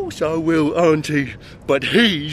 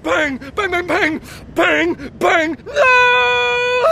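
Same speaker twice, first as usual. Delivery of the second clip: high, loud, sobbing gunshot shouts; hysterical shouting; 13 semitones higher, 4 dB louder, much slower than usual. Retold or imitated. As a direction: break into panicked crying mid-shout. Reliving the shooting.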